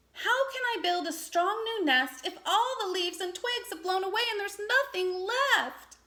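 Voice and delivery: fretting over his lost nest; anxiously. A narrator's reading. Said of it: "he asked anxiously".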